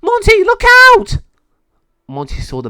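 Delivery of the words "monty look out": very high, loud scream of warning; shouted warning. A narrator's reading. Casey screams to warn Monty of net. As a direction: yell it to save him.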